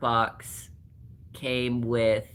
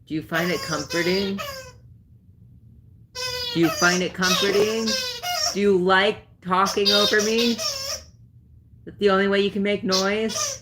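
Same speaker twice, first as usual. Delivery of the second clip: raised sing-song voice teasing the dog; 7 semitones higher than usual. Real, not acted. Owner teasing her squeaking dog in baby talk. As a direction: coo at the dog playfully.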